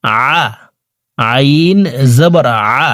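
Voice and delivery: careful আইন জবর আ with the ayn made mid-throat. Shown as a demonstration. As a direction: squeeze আ from the middle of the throat. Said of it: "হলকের মধ্যখান থেকে এইভাবে". (আ হলকের মধ্যখান থেকে উচ্চারণ)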